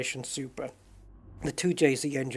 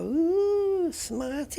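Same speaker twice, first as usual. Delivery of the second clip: much higher mocking "Ooh, smarty"; mocking friend; much slower than usual. Friend teasing him for saying Volkswagen properly. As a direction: tease like an unimpressed mate. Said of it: "they're gonna go".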